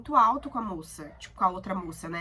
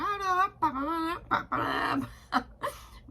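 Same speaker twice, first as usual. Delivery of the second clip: high giggle; 8 semitones higher than usual. Real, not acted. Laughing at her rushed answers.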